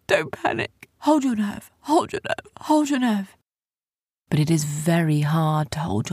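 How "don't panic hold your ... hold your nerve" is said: high, tense self-reassurance; anxious repeated high-pitched urging; panicked self-talk. A narrator's reading. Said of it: "He panicked".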